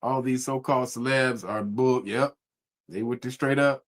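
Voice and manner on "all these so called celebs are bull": ordinary talk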